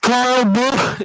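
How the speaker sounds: high, loud yell chopped off on "Busch!"; cut-off scream. Retold or imitated. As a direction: scream it, "Busch!" cut dead like a dropped mic. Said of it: "so it just sounds like".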